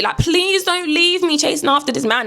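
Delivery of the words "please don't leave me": high, drawn-out wail; imitated desperate cry down the street; mock screaming plea. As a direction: beg in a stretched, high mum voice. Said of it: "she's just screaming on the street".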